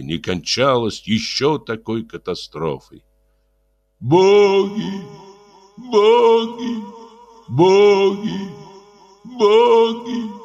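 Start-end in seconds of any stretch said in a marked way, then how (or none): 4.0-10.3 high, louder moaning crowd; стон и плач. A narrator's reading. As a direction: moan and weep the word. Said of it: "стонал и плакал народ". (стонущий плач народа)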